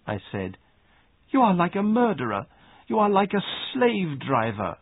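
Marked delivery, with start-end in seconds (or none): 1.3-4.8 put-on high-pitched girl's accusation; indignant accusation. A narrator's reading. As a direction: throw the accusation at the bully in a raised pitch. Girl accuses her cousin.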